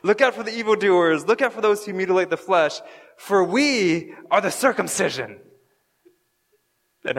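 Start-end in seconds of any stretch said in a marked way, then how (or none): 0.0-5.4 high, loud cheering crowd with a laugh; loud, fast chant of agreement; excited crowd. Pretend. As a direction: hype it up like a fired-up congregation. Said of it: "everyone's like".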